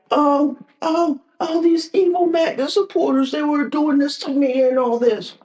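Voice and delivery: high mocking whine; mocking crybaby voice. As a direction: whine like a tattling child. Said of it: "Don't, don't cry to my face saying that".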